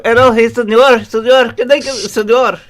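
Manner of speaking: high hammed-up Mexican accent; mock Mexican accent. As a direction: put on a loud Mexican accent. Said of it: "read him like a Mexican".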